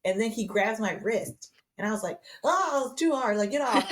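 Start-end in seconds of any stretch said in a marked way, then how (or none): 2.4-3.9 high, louder laughing cry of protest; laughing mock protest. Pushing her dad's grip off her wrist, laughing high protest. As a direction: squeal it at him, half laughing.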